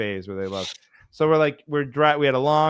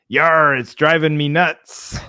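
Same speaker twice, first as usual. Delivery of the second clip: pirate voice; 4 semitones higher, 6 dB louder, slower than usual. Acted. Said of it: "he says".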